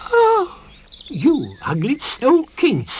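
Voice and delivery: high, loud taunt; mock the stranger; jeering. A narrator's reading. Sniff taunts the stranger king, high and loud.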